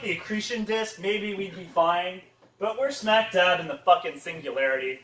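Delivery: usual speaking voice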